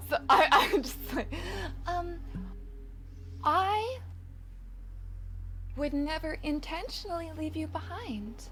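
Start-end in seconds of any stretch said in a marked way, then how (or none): none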